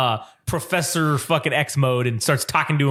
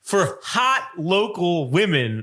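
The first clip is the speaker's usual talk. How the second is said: mock ad read.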